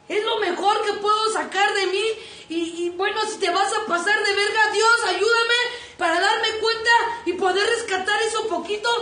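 high, loud pleading cry; loud desperate prayer; impassioned shout. Acted. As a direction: beg God at full voice. Impassioned plea to God.

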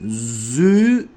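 zü with tongue between teeth, drawn out; lisped Z. Shown as a demonstration. Teaching learners the lisped letter, long lisped zü. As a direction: bite the tongue and hold the z of zü. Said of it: "dilimizi ısırarak zü diyeceğiz".

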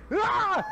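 high startled mock scream. Retelling a teammate's scream, a high mock shriek. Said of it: "it was like".

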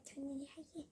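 whispered confession; whispered secret. Pretend. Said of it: "나도 마음속 얘기할 테니까".

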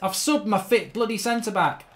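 ordinary talk

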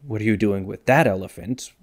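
accent lands on THAT, rising at the end; stress on THAT. Shown as a demonstration. Comparing six questions for viewers, stress on THAT. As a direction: punch THAT before elephant. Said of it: "where you place the accent".

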